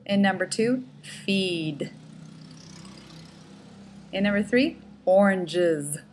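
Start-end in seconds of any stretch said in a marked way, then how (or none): 1.0-1.9 "feed" with a firmly voiced final d; strong voiced ending. Shown as a demonstration. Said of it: "make sure they're very strong endings". 5.0-6.0 "oranges" with a buzzing final z sound; strong voiced ending. Shown as a demonstration. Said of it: "make sure they're very strong endings".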